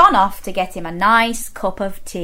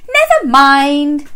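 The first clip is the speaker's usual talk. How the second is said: higher, slow singsong consolation; soothing Missus Bear; 4 semitones higher, 4 dB louder, slower than usual. Acted. Missus Bear consoling tired husband, high singsong. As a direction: console him in a high lilt. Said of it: "said Missus Bear".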